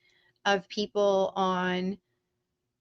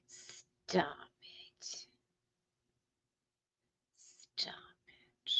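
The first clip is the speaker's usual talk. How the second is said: hushed whisper, almost no voice; 8 semitones lower, 19 dB quieter, much slower than usual. Real, not acted. Whispering to her viewers after teasing the antis. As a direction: breathe it out, teasing hush.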